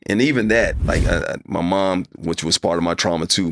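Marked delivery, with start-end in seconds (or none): none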